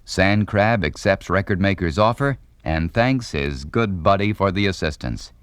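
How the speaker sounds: ordinary talk, nothing marked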